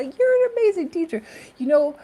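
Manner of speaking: high, loud gushing praise; quoting fans. Retold or imitated. Quoting people who praise her, high gushing voice. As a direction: gush like an admiring fan. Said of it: "a lot of people say".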